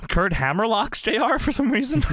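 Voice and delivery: ordinary delivery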